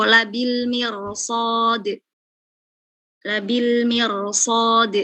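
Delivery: "labil mirsad" said slowly, thick r; slow demo. Shown as a demonstration. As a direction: drag out "mirsad" heavily. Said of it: "Contoh lagi". ("labil mirsad" dibaca pelan dan tebal)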